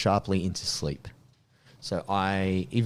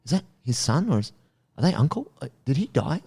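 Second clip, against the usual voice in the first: higher, lightly confused questions; confused girlfriend; 8 semitones higher than usual. Retold or imitated. Girlfriend lost in the Star Wars plot, mildly high confused asking. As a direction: lightly play a lost new viewer. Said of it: "She's like".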